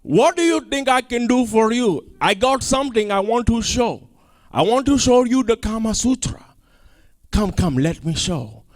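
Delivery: lightly put-on Middle Eastern accent; slick, inviting foreign voice; hint of an accent. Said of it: "And so he like".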